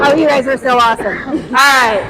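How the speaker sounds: ordinary delivery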